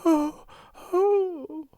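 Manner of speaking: high woeful oh, oh; mild woeful cry. A narrator's reading. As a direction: moan it in dismay. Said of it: "she cried woefully".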